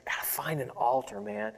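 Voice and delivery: inner thought. Retold or imitated. Preacher recalling his young self's hidden longing, softly inward. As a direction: say it to yourself under your breath.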